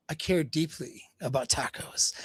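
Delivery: soft, mock-earnest declaration; feigned sincerity. Jake pretending to care to the women, feigned sincerity. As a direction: fake that you care deeply, quietly. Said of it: "Kinda fakes, uh, acts like".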